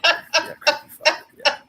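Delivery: high and loud through laughter; cracking up while saying creepy fucker; creepy fucker, giggled high and loud; laughing. Acted. Repeating insult, laughing.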